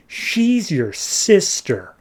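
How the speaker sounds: high, slow cliffhanger line; dramatic reveal. Acted. Shadow tells Oliver about Emiko, dramatic reveal. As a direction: stretch each word for a big reveal.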